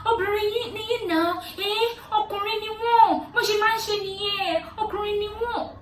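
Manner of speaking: high, loud mock of the women in Yoruba. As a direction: parrot the women's excuse for men. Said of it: "started saying".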